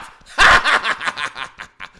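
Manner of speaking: loud mock evil laugh; hammed-up maniacal cackle; staccato villain laughter, falling.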